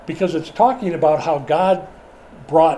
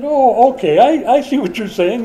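amused realization; 8 semitones higher, 5 dB louder than usual. Retold or imitated. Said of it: "I said".

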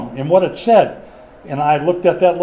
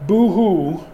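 mock boo-hoo; 4 semitones higher than usual. Mocking crying.

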